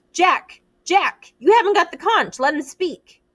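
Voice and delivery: high urgent yell at Jack; shouting. A narrator's reading. Ralph shouting Jack down at the assembly, urgent yell. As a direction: cut him off, loud and sharp.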